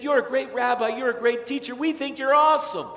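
a touch of hammed-up enthusiasm; eager admirers. Crowd flattering the rabbi.

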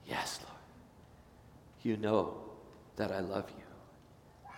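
soft, hushed, slow reply; broken whisper. Acted. Peter answering Jesus ashamed, near-whispered and slow.

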